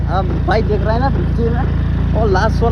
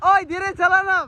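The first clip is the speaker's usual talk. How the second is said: shouting; 10 semitones higher than usual. Real, not acted. Friend driving too fast.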